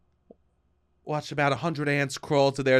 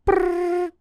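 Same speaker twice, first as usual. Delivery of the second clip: high rolled brrr like a ringer; imitating a ringing phone, high trill; 13 semitones higher than usual. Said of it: "Do the rings for me".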